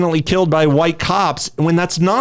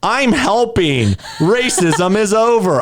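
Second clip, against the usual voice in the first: higher, drawn-out mock-proud declaration; slow, self-congratulating mockery; 5 semitones higher, slower than usual. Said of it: "It was just mocking".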